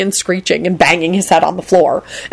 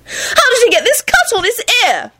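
much higher, agitated cry; high-pitched alarm. A narrator's reading. Woman demanding to know about his injury, high-pitched alarm.

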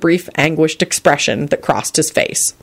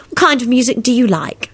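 high, lightly stern question; mildly stern; 8 semitones higher than usual. A narrator's reading. Elizabeth quizzing Lupin on music, mildly stern. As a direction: quiz him in a higher, prim tone. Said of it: "she asked him sternly".

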